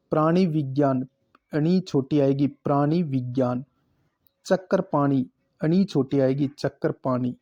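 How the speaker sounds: usual speaking voice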